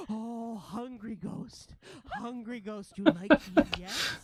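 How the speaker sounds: high, slowed wonder at the ghost; awed ratling. Acted. Ratling offers cheese to a hungry ghost, high awed voice. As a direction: coax timidly in a high creature voice. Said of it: "and she's like".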